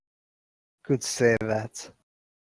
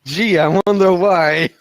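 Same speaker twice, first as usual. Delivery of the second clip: sarcastic retort; 4 semitones higher, 8 dB louder than usual. Acted. Character retorting to the uncle's questions, loudly sarcastic. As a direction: throw it back with heavy mock wonder.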